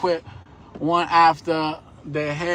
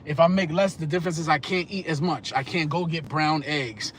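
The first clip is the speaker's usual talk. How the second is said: ordinary talk, nothing marked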